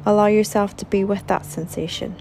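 calm, measured meditation guide with long pauses; soothing relaxation-exercise voice, phrase by phrase; guided meditation. Acted. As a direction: unhurriedly guide them through a body scan, leaving space to breathe. Leads a guided body scan meditation.

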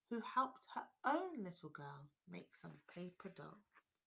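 ordinary delivery, nothing marked

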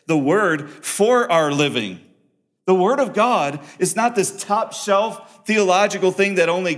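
loud, high, fast-driving preacher's voice; full-force preaching. Acted. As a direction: press the truth hard on the listeners.

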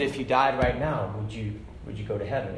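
low, soft voice of a retold preacher; gently asking. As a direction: ask it low and softly, imitating the preacher.

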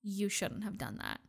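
very soft, hushed acted line; hushed, understated imitation of reproach; retold quiet reproach. Retelling Caroline's reply to the plea.

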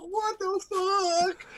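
high drawn disbelief; amazed. Retold or imitated. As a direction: stretch it out in awe. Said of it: "I'm like".

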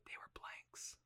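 hushed, whispered twist reveal. Retold or imitated. Filmmaker revealing his film's twist to friends, whispered. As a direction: drop right down to a whisper for the reveal.

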